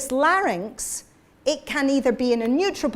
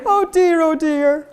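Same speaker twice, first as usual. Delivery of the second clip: high and loud, sobbing "Oh dear, oh dear"; thin fold voice; 6 semitones higher, 7 dB louder than usual. Shown as a demonstration. Teacher leading the group in a sobbing "Oh dear". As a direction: cry it out high, like a sob. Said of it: "And again".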